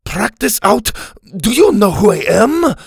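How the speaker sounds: high, loud outraged boast; indignant swordsman bristling. A narrator's reading. Inigo outraged.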